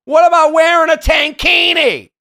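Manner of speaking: high, loud mock shout of a heckler; imitating a loud audience heckler; mimicked heckler yell. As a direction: yell it out high like someone interrupting a show. Retelling a drunk heckler yelling out.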